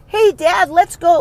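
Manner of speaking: put-on very high, loud kid voice; little Jack. Little boy coming up to his dad, high-pitched imitation. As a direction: squeak it like an eager little boy. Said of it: "little Jack comes up to Dad".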